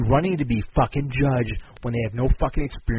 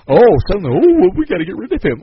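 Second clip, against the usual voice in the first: mock outrage; 5 semitones higher, 8 dB louder, faster than usual. Mocking people suddenly outraged.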